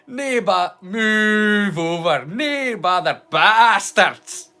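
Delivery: a light Scottish recap of the joke, slow on "move over"; Scottish accent. Shown as a demonstration. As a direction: rattle off the punchlines in a Scottish burr. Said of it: "that's how it would be said there".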